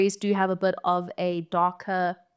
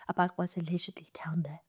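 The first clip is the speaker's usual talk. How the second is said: whispered; 3 semitones lower, 9 dB quieter than usual. Acted. Spooky hint about the creature.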